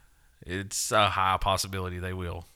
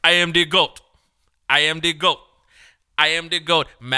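loud, higher chant-like boast; imitating African rapper; 6 semitones higher, 9 dB louder than usual. Retelling the rapper's Goat song, loud imitation. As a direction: declare it like a booming rapper. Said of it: "and he goes".

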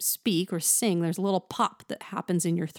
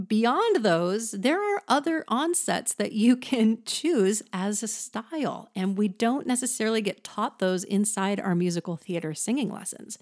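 ordinary delivery

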